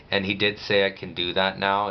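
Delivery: usual speaking voice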